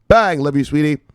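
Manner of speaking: high loud burst on "Bang"; excited shout. Real, not acted. Host cheering a viewer's name with a loud bang. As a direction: punch it out like a cheer.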